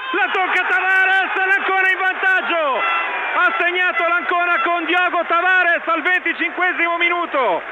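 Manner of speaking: high, loud goal call; breathless italian goal commentary. Real, not acted. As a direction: scream the goal to the listeners. Radio commentator calls a goal.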